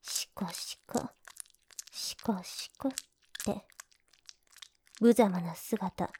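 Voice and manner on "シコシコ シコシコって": soft whispered stroking chant; quiet teasing count; rhythmic whisper. Acted. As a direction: whisper the rhythm to guide him.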